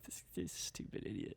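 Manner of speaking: very soft, low mumble; barely voiced retold grumble; imitated muttering. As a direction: mimic a dismissive remark under the breath. Imitating dad's private mocking comment.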